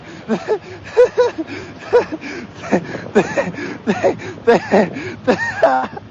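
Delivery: long breathless giggling fit; a real, drawn-out overwhelmed laugh; giddy long laugh. Real, not acted. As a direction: laugh helplessly in disbelief. Giddy over the flood of donations.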